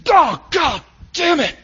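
high, drawn-out frustrated shout; exasperated yell, pitch way up; acted angry cursing. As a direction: curse loudly as if really fed up. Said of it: "used it with emotion and expression".

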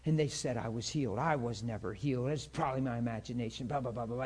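low, quiet mock grumble; understated imitation of a man who's mad; angry grumbling. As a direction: grumble it dismissively, acting out someone sore. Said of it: "He got so angry".

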